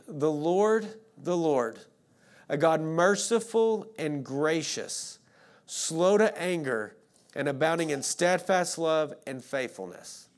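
slow, weighty reading of scripture, each phrase set apart; measured, reverent declaration; slow solemn proclamation. Shown as a demonstration. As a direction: proclaim it slowly, letting every name land. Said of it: "Listen closely".

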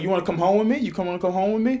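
high-pitched, singsong invitation to a dog; mock sweet-talking a pet; imitated dog coaxing. Acts out woman coaxing the dog.

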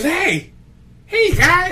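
high, loud imitation of a drunk; drunk barfly. Drunk at the corner hails the guy, mock-drunk holler. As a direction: holler like a comic drunk, pitch way up. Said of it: "was the drunk at the corner".

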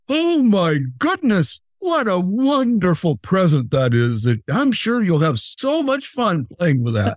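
put-on wonder, pitched well up; enthusiastic. Shown as a demonstration. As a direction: gush over it to please a child. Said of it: "my enthusiastic".

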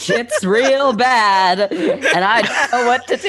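drawn-out, high, laughing character line; slow laughing character voice. Acted. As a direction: stretch it out slowly while laughing. Character wobbling like newborn.

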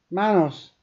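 high, loud panicked cry of a name; panicked call. A narrator's reading. Woman alone calling for her husband, panicked. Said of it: "A note of sheer panic in her voice now".

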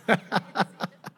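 brief soft chuckle; quick quiet laugh; short laugh. Real, not acted. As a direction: laugh at your own joke. Preacher laughs at his joke.

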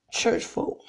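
hushed, pointed whisper. Acted. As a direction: drop to a breathy whisper on church folk. Sermon refrain trails into whisper.

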